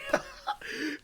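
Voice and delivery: high burst of laughter; amused laugh. Real, not acted. Laughing at how cousin trolled her, amused. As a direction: crack up at the story.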